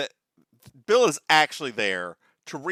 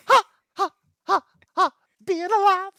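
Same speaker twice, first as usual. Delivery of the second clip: high, loud, breathy ha ha being alive; overexcited; 8 dB louder than usual. Acted. Cohost hamming up the movie's stirred-up feelings, overexcited. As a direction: gasp it out with mock passion. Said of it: "You were really excited for that one".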